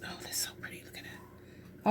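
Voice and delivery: very soft whisper to oneself; hushed admiration. Real, not acted. Crafter admiring the pretty pink addition, hushed admiration.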